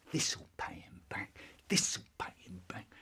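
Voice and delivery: hushed whisper of revenge; whispered thought. Retold or imitated. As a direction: whisper it gleefully to yourself. Child's secret vengeful thought.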